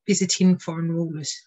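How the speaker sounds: usual speaking voice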